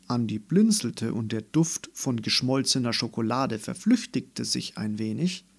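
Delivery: plain reading voice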